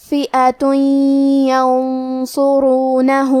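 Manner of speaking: slow chant, tanwin of فئۃ hummed into a doubled ya of ینصرونہ; nasalized merging. Shown as a demonstration. Teacher shows idgham, slow chant with nasal ya joining فئۃ ینصرونہ. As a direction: recite فئۃ ینصرونہ as "fi'atuy-yansurunahu" with a held nasal hum. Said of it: "غنہ اور تشدید".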